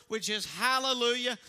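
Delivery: ordinary talk, nothing marked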